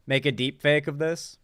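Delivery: ordinary talk, nothing marked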